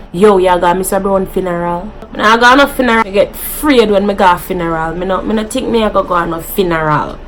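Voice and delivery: quick, lightly voiced Jamaican patois talk; a hint of quick Jamaican patois. Shown as a demonstration. YouTuber acting out Jamaicans talking about funerals. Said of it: "we say".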